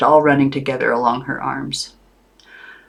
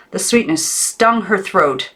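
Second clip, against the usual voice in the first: plain reading voice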